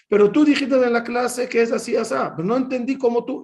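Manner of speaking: put-on a keen fourteen-year-old insisting on tú; imitating his teenage self. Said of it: "le decía al maes-, al rabino". (alumno de catorce años hablándole al rabino, voz aguda y ansiosa)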